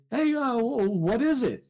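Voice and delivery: high, anxious call to the tower; Jack Tover calling up. Acted. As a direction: shout up asking what it is. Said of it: "you hear Jack Tover say".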